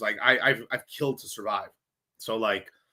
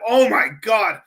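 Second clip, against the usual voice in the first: retold shock; 8 semitones higher, 7 dB louder, slower than usual. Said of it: "And I'm like".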